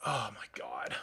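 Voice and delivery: whispered, seething; pissed-off whisper. Real, not acted. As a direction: mutter it under your breath in disbelief. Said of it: "I'm pissed off".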